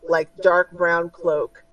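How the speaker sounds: ordinary delivery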